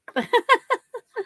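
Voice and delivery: high giggling laugh; a short burst of giggles; chuckle. Real, not acted. Chuckles after own remark.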